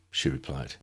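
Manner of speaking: plain reading voice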